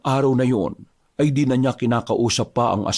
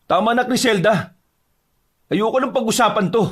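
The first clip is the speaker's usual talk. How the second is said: high-pitched, heated refusal; angry cut-off; 10 semitones higher than usual. Acted. Husband refusing to discuss it with his wife, angry. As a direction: shut the argument down, fed up.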